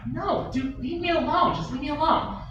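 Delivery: high, whiny plea to be left alone; annoyed brush-off. Acted. To his pestering partner, a high exasperated whine. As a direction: squeal it out, fed up.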